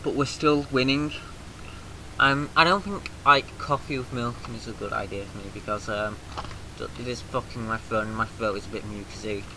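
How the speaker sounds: ordinary talk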